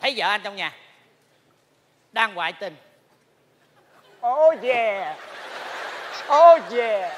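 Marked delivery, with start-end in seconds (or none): 4.3-7.1 hammed-up moans rising louder; mock lover's moan, building loud; mock moaning wife. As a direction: play the cheating wife, over the top. Acts adulterous wife moaning.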